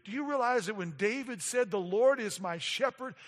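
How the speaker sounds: rising passionate sermon climax; full preaching. Acted. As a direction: drive it home from the pulpit.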